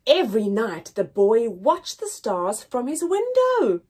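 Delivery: plain reading voice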